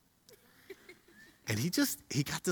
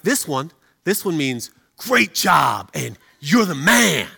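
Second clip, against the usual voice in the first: slow emphatic; 7 dB louder, slower than usual. Retold or imitated. Dad demoing another signal.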